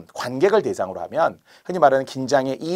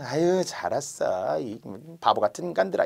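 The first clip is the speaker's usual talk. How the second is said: fake apology; 7 dB quieter than usual. Servant only outwardly apologizes to nobles, softly mocking. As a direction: humour them quietly while secretly sneering. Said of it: "겉으로만 변명하는 채 하는".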